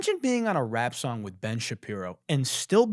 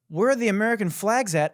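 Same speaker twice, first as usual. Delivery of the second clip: high, louder mocking question; incredulous echo; 7 semitones higher, 5 dB louder than usual. Host throwing lyric back at Canadian rapper, incredulous.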